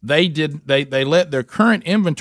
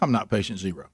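muttered denial; 5 semitones lower, 7 dB quieter than usual. Shown as a demonstration. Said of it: "I'm having to now say to my own wife".

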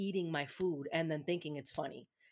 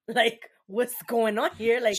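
raised, loud, baffled outburst; loud disbelief; 4 semitones higher, 10 dB louder, slower than usual. Retold or imitated. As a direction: blurt it out in shock. Discovering empty cereal box.

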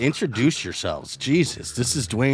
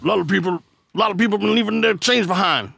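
Mister T; 9 semitones higher than usual. Shown as a demonstration. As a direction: play a tough bodyguard, a bit overdone. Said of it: "Let's, let's do, like, Mister T".

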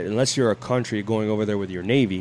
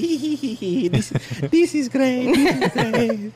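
high, giggling mock wife voice; giggly wife impression; 12 semitones higher than usual. Husband mocking his wife on camera, high and giggly. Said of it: "She's like".

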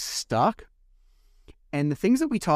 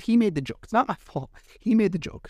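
higher-pitched protest, self-justifying; defensive protest; 7 semitones higher than usual. Real, not acted. Man defending himself over being told off by the judge, defensive. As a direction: plead innocence, pitch lifting on each claim.

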